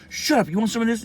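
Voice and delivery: high-pitched scolding outburst; mom snapping a threat. Retold or imitated. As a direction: fire it off as a fed-up mother. Angry mother in retold scene.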